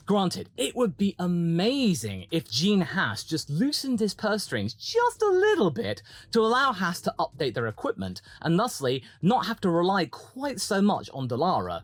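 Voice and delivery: ordinary talk, nothing marked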